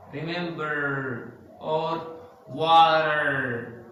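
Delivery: "Remember" and "water" stretched on the final R; slow, loud "Remember" and "water" ending in "errr"; stressed final R. Shown as a demonstration. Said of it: "they stress in the E R".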